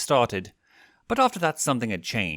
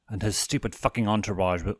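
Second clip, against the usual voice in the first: plain reading voice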